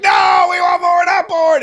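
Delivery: high, loud hammed-up crowd yell; mock crowd shout. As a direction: shout it like an eager press pack. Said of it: "they're all like".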